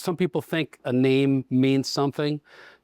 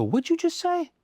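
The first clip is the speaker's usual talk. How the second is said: much higher, startled retort; incredulous. Retold or imitated. GM retelling his reaction to the detailer, high and incredulous. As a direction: act shocked by what you just heard. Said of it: "And I go".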